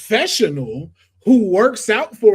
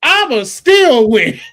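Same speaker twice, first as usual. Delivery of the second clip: wrestler trash talk; 7 semitones higher, 7 dB louder than usual. Retold or imitated. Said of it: "He said".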